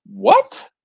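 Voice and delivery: high, loud incredulous question; mock disbelief. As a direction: blurt it as a retold shocked reaction. Acts out his surprised younger self.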